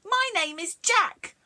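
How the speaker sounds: high, loud protest; head refusing the miller, high and loud; Jack's head. A narrator's reading. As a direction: cry out as the head. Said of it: "The head said".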